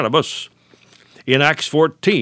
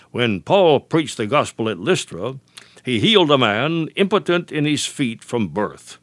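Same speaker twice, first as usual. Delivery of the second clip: ordinary talk, nothing marked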